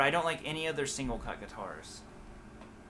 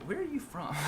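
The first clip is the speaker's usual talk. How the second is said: puzzled stranger; 11 semitones higher, 4 dB quieter than usual. Retold or imitated. And people.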